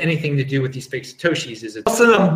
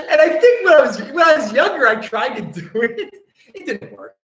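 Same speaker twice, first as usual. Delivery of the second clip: laughing; 8 semitones higher, 3 dB louder than usual. Real, not acted. Laughing at own story.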